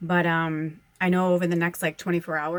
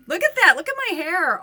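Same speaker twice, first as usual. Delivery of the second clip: delighted squeal; 11 semitones higher, 8 dB louder than usual. Real, not acted. Excited about her own hair.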